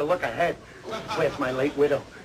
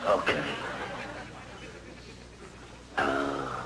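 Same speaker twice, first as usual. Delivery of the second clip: low, weak croak; hoarse, suffering; 9 dB quieter, much slower than usual. Acted. Patient giving in to a sip of water, hoarse. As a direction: give in feebly, then groan. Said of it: "You don't sound so hoarse".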